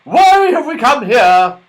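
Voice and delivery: high, loud, exasperated outcry; annoyed. A narrator's reading. Dame Washalot to everyone after the landing, annoyed. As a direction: squawk it out, thoroughly put out. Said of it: "in a most annoyed vo-, voice".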